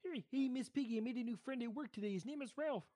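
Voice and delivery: high puppet frog voice; high-pitched frog voice, a Muppet impression; Kermit the Frog. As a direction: play Kermit chatting to Miss Piggy. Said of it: "Kermit talking to Miss Piggy".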